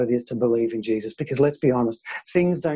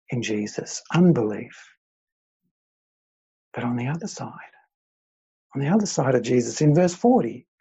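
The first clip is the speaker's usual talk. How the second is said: usual speaking voice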